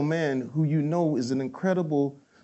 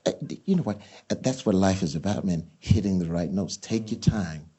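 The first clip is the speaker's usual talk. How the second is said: [name] impression; 7 semitones lower, 4 dB quieter than usual. Actor imitating [name] giving life advice, lower and softer. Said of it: "He says".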